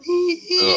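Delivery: high thin squeaking rat cry; rat squeal. Retold or imitated. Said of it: "They'd be like, you know".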